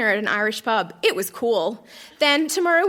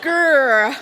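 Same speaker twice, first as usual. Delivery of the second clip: mock growl; 6 dB louder than usual.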